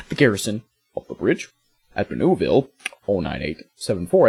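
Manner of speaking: halting presidential briefing with long pauses; measured statesman's delivery, stretched phrases and hesitant uhs; Obama. Acted. Player mock-reads the mission briefing as Obama, halting and presidential. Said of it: "that was my impression of Obama".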